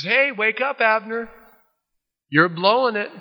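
high teasing call-out; high-pitched imitated taunting holler; mock chiding. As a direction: scold playfully from far away. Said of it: "he chides Abner".

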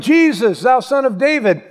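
high, loud drawn-out cry for mercy; yelling. Retold or imitated. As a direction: cry out slowly, stretching each word. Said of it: "He started yelling".

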